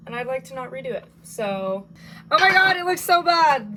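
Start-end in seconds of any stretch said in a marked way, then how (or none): 2.3-3.7 loud, high-pitched exclamation; delighted horror. Real, not acted. Baker seeing her messed up loaf of bread, loud delighted horror. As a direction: burst out loudly at a comically awful result. Sees the ruined loaf come out.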